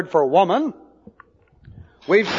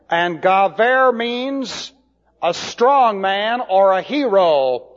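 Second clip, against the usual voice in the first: usual speaking voice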